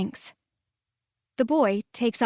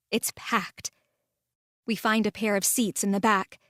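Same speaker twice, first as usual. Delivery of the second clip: ordinary talk, nothing marked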